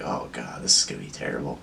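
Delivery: low, soft, dreading mutter under the breath. Retold or imitated. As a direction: groan it out low like you already regret it. Dreading the messy burrito.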